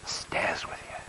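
barely voiced, deeply moved; moved whisper. Real, not acted. Preacher echoing the waitress, in a moved whisper. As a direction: let it fall to a whisper.

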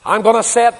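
loud, high, ringing proclamation; Paul's rallying call. Acted. Preacher voicing Paul, calling the church to answer loudly. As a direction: call it out to the whole hall.

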